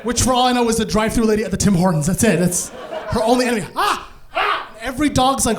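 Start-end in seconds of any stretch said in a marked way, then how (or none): 3.8-4.7 two short high-pitched yelps; mock screams. Mom screaming at shelter dogs, short high mock screams. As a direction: imitate mom's startling high "ah!". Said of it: "Just screaming at dogs".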